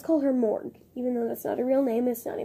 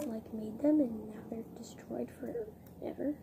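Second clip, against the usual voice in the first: whisper; 2 semitones lower, 8 dB quieter than usual. Real, not acted. Whispered aside about church rule.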